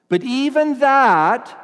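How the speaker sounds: "that" pitched high, slow and loud, rising; stress on that. Real, not acted. Preacher stressing "that" to show belief alone is not saving faith. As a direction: lift "that" high and slow before the turn. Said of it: "slow emphatic build".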